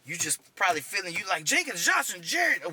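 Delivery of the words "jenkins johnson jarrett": higher-pitched frantic name-calling. Retold or imitated. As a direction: call out for missing comrades, pitch jumping up. Dazed soldier calling for comrades.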